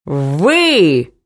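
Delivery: drawn-out, indignant mock yell; understated narrated shout of accusation. A narrator's reading. Man advancing on mama, yelling mildly. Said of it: "заорал мужчина".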